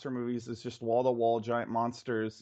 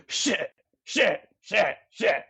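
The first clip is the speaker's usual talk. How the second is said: higher grunted monster curse; 5 semitones higher, 5 dB louder, slower than usual. Retold or imitated. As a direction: grunt the word like a beast. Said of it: "grunting sound ... saying the word shit under his breath".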